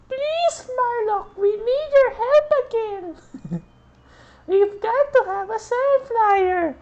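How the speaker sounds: put-on high, loud squeaky German-accented plea; mock German accent, pleading falsetto. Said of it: "German Nazi accent".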